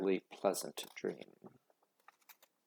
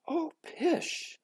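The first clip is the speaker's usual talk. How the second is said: much higher, brushing it off; dismissive scoff. A narrator's reading. Mother brushing off her daughter's worry, dismissive. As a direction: wave the worry away.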